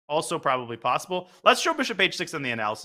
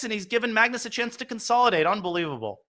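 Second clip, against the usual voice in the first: usual speaking voice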